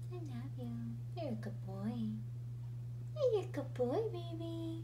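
high, loud doting coo. Real, not acted. Scolding then cooing at dog.

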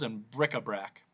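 ordinary delivery

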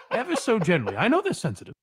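gentle, sleazy fake shrink; creepy soothing therapist. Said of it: "the most disgusting therapist voice".

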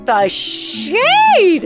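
a loud, stretched-out, scandalized "shade"; exaggerated gasping reaction. Real, not acted. Streamer reacting to a catty line in game, scandalized. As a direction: drag out "shade" long, high and loud. Reads insult, dragging out shade.

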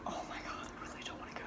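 real anxious whisper, barely voiced. Real, not acted. Anxious student dreading school, whispering to the camera. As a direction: breathe the words out, dreading school. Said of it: "really freaking out".

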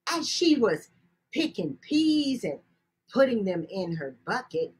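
plain reading voice

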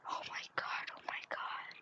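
very soft, voiceless urging; urgent whisper. Real, not acted. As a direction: whisper it so the seeker won't hear. Seeker is close by.

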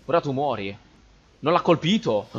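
ordinary talk, nothing marked

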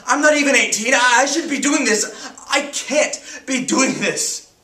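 high, loud, bitter protest; anguished outrage. Acted. Teenager told to decide brother's death, anguished outrage. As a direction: cry out against the unfairness. Outraged at life-support decision.

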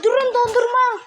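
loud, high eager shout; excited call. Real, not acted. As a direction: call out to stop everyone, thrilled. Kid spots ice cream, calls out.

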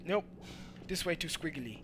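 normal talking voice

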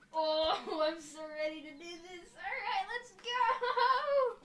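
rising excited shout, laughing; excited yelling. Real, not acted. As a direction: yell with hype, voice cracking up. Hyped before launching the mech.

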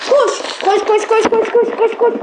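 panicked urging while chased; being chased. Real, not acted. Being chased, telling self to run.

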